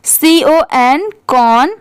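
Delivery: letters spelled out slowly, one by one; slow teacher spelling C O N. Shown as a demonstration. Teacher spells it out slowly for her students. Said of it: "जैसे देखिए कॉन".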